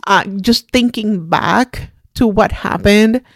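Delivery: ordinary talk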